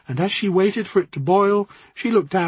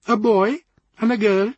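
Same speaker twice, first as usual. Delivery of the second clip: put-on higher, knowing Jamaican accent; 4 semitones higher, 4 dB louder than usual. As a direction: Jamaican lilt, certain and calm. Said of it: "in a rich Jamaican accent".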